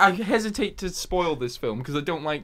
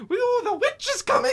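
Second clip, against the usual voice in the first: much higher, strange squeaky crazy-man voice; weird high-pitched voice. Retold or imitated. Imitating a film character gone insane, squeaky high voice. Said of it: "weird high-pitched voice".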